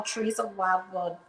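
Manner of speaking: slurred around a mint; mumbled with a mint in mouth; mouth full. Real, not acted. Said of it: "sorry, I have a mint in my mouth".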